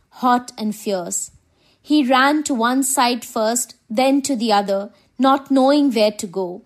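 ordinary delivery, nothing marked

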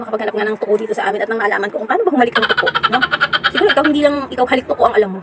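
ordinary talk, nothing marked

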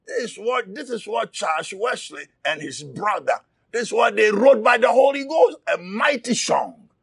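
normal talking voice

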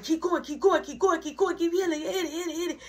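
high, excited chant egging him on; friends cheering. Retold or imitated. Friends urging him on as he smokes, high excited chant. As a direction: hype him up to keep hitting it. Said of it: "they like".

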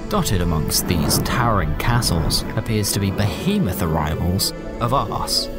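plain reading voice

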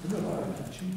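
a private dry jab said under the breath; softly voiced sarcastic thought; inner thought. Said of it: "I'm thinking".

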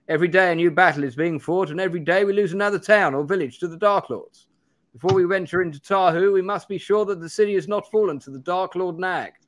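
put-on high-pitched, urgent young magician; Benedict Cumberbatch. Young magician warning of towns lost to war, Cumberbatch impression. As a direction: play a grave character, pitched well up. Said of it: "this is Benedict Cumberbatch playing this character".

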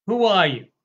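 loud, high-pitched demand; demanding question. Acted. Envoys from Jerusalem demanding John name himself, loud. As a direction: push him hard for an answer.

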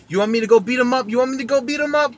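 hammed-up tough mom offering to fight; aggressive Staten Island mom. Staten Island mom offers to beat up the cheating boyfriend, imitated aggressive and fast. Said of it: "really aggressive".

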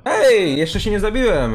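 wysoki, głośny okrzyk gracza; podekscytowany okrzyk. Real, not acted. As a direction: wykrzyknij to głośno, z ożywieniem. Reakcja gracza w grze. (ożywione wołanie)

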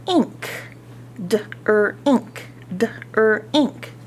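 "D, er, ink" split into sounds; syllable by syllable. Shown as a demonstration. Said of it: "teacher segments d-r-ink".

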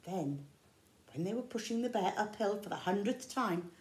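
ordinary delivery

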